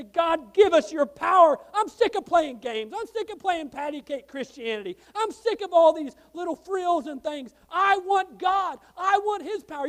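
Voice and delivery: impassioned preacher's cry to God; forceful, fed-up pleading, punched hard; fervent plea. Acted. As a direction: cry out the prayer with rising force. Models a fervent prayer aloud.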